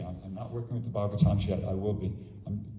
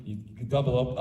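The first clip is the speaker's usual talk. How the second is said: ordinary delivery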